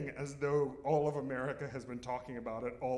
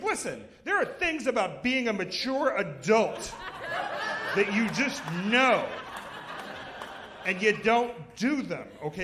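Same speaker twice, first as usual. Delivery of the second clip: mock lecture; 6 semitones higher, 8 dB louder than usual. Lecturing mother-in-law, loud.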